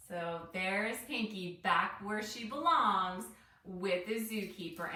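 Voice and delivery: plain reading voice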